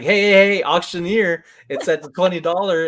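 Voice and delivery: loud, high call across the room. Retold or imitated. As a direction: call out to get her attention. Calling out to wife in chat.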